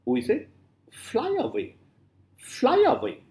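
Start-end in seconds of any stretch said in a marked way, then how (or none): 0.9-1.8 "fly away" with a high FLY, then two light syllables; stress on FLY. Shown as a demonstration. Teacher showing students a dactyl, stress on FLY. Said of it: "first is, uh, is stressed". 2.4-3.2 "fly away" with FLY pitched very high; stress on FLY. Shown as a demonstration. Teacher demonstrating the dactylic pattern, stress on FLY. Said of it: "first is, uh, is stressed".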